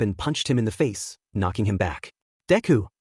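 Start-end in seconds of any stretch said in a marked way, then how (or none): 2.5-2.9 sharp higher call of his name; exclaiming. A narrator's reading. Ochako crying out as Izuku punches Rocklock, exclaiming. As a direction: blurt the name out in alarm. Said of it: "Ochako exclaimed".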